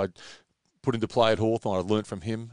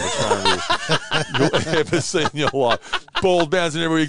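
laughing; 5 semitones higher, 4 dB louder than usual. Real, not acted. Laughing through the story.

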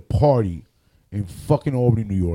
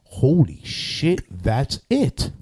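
slow amazed realization; 3 semitones higher, much slower than usual. Retold or imitated. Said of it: "And I was like".

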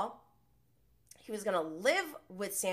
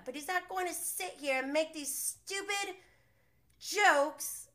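high, fast whiny parody of an angry rant; a high mocking take on Sandoval's outburst; 8 semitones higher than usual. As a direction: mimic his ranting in a squeaky pitch.

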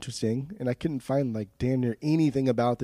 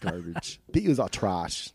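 jokey attempt at a Scottish-English accent; mock Scottish-English accent; 5 semitones lower, slower than usual. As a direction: play at a British accent, half Scottish, half English. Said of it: "Scottish slash English accent".

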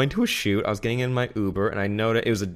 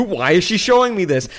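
higher, louder retold disbelief; baffled driver; 9 semitones higher, 6 dB louder than usual. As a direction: imitate a driver asking in rising alarm.

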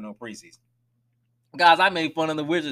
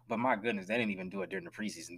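quiet aside; 5 semitones lower, 10 dB quieter, faster than usual. Real, not acted. Aside remark.

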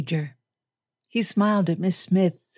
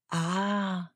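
soft, understated gravity; grave; 5 dB quieter than usual. A narrator's reading. Lady answering the major's remark, softly grave. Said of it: "she said gravely".

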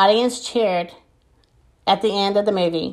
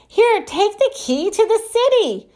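squeaky mayor voice; 11 semitones higher than usual. Acted. Mayor voiced, high far.

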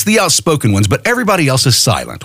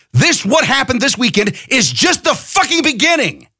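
angry; 9 semitones higher than usual. Real, not acted. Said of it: "Yes, I'm angry".

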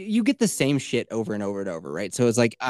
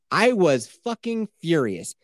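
normal talking voice

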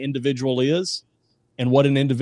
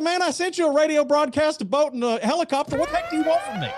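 much higher, incredulous punchline; exasperated God; 12 semitones higher, faster than usual. Acted. God to the drowned man, exasperated and much higher. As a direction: throw up your hands as an exasperated Lord.

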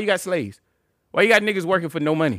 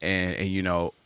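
lower, measured host voice; mock talk show host; 9 semitones lower, 4 dB quieter than usual. Posing as host questioning his guest, mock talk show host. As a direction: lightly play a serious interviewer.

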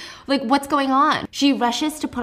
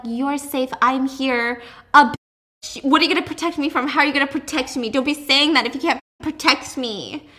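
mocking; 4 semitones higher, 5 dB louder than usual. Mocking Adam then Juliet.

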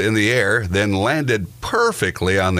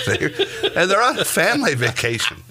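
higher, laughing through the words; 12 semitones higher than usual. Real, not acted. Host telling of sisters' naked fight, breaking up laughing. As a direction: crack up while getting the words out.

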